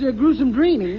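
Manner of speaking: normal talking voice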